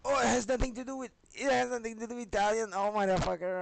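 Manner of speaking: loud, high, fast burst of shock; excited yell. Real, not acted. Reacting to the discovery, loud, high and fast. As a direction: blurt it out, stunned and thrilled. Shocked realisation while reading.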